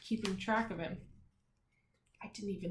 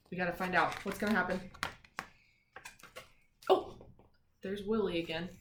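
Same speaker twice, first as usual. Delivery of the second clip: ordinary delivery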